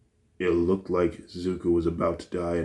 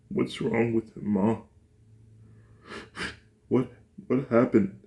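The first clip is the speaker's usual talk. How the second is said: put-on tearful child; 5 semitones higher than usual. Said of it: "with tears running down his face".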